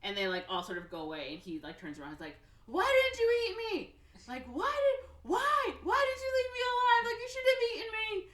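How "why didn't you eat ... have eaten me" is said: very high, loud indignant wail; mad, acted. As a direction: yell in hammed-up outrage at being spared. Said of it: "he's so mad".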